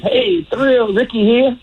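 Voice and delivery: a hint of cocky Ricky at first base; mild high imitation of Ricky; Ricky's third-person voice. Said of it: "he always talked in the third person".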